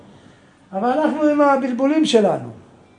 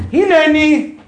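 loud, high, building to a shouted answer; rousing call-out; 6 semitones higher, 7 dB louder, much slower than usual. Acted. Preacher voicing the forefathers answering God, rousing shout. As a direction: drive it up into a full-voiced reply.

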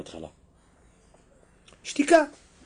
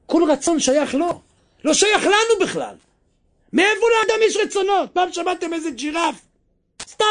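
higher and louder with each clause; rising fervent preaching; 10 semitones higher, 3 dB louder than usual. Acted. Preacher asks listeners where desires come from, rising fervour. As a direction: build to the question. Climax on will belonging to God.

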